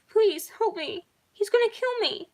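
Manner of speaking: high, loud panicked plea; crying girl. A narrator's reading. Bella begs the operator for help, high panicked crying. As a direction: beg for help, voice high with terror. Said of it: "Bella cried".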